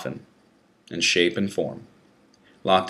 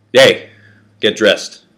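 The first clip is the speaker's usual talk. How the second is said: barked guard command, louder; officer's order; 8 dB louder, slower than usual. Acted. Guard rousts the prisoner from his cell, loud order. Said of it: "an officer ordered".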